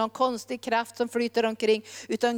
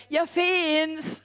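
higher, drawn-out proclamation; calling out; 4 semitones higher than usual. Acted. God calls through nature to people, calling out. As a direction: call it out as God's message. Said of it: "Han ropar".

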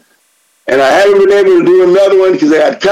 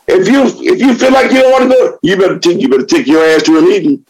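usual speaking voice

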